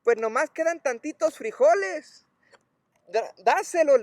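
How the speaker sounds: high-pitched mock household yell; imitated yelling. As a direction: imitate a woman calling to her in-law. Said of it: "le grita y le dice".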